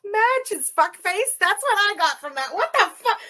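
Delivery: loud, high bewilderment; baffled outrage; incredulous repeat. Real, not acted.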